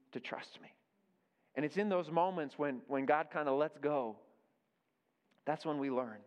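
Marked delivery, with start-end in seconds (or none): 0.1-0.7 whisper. Acted. God's gentle voice ends softly.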